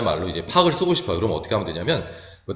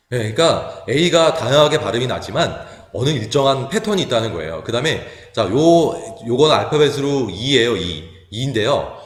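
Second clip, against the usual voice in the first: ordinary delivery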